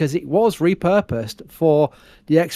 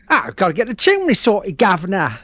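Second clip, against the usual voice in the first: put-on higher-pitched Mary Poppins-style English accent; mock English accent; 4 semitones higher than usual. Brit teasing co-host over his bad impression, mock English chimney-sweep accent. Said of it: "Mary Poppins impression again of an English accent".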